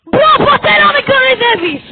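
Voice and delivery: loud, high scolding bellow; hammed-up telling-off, full volume; mock stern shout. Gamer mock-scolding a player for mocking, loud.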